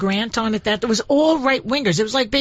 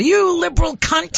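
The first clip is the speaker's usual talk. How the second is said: slow, higher mock snarl; hateful caller; 8 semitones higher than usual. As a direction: spit it out like a nasty caller.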